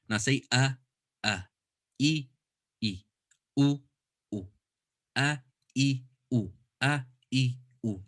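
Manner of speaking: short a, e, u said one by one; vowel drill. Shown as a demonstration. Teacher drilling a student on the vowel sounds. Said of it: "I'd tell them".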